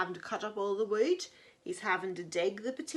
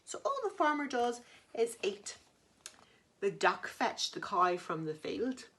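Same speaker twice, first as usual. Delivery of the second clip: usual speaking voice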